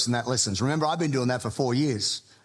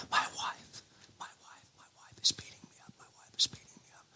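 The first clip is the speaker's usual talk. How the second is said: breathy whispered confession, much softer, rushed; mock whisper; 12 dB quieter, faster than usual. Caller whispering his story to the pastor, in a fast mock whisper. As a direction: hush it like a secret, fast.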